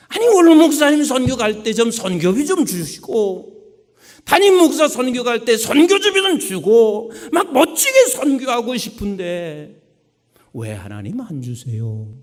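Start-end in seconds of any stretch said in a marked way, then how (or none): 0.1-9.8 high, loud pleading sermon; fervent preaching. Acted. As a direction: push the congregation with passion. Preaching at full force.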